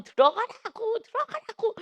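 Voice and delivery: 확 높은 음으로 거듭 다그치듯; 힘주어 높이 치켜올린 재촉; 다그치는 재촉. Acted. 하나님의 명령을 다그치며 재연. (높은 목소리로 다그치며)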